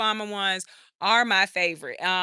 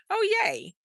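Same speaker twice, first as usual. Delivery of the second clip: excited; 12 semitones higher, 3 dB quieter than usual. Real, not acted. Delighted reaction to viewer comment.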